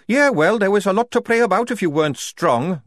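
a bitter soldier growling about her past; growled. A narrator's reading. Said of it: "growled Tonka".

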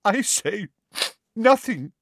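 high, choked-up theatrical professor. A narrator's reading. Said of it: "she choked".

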